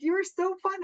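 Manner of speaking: retold gushing compliment. Telling a stranger at the gas station, retold gushing. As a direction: gush it, re-enacting the moment.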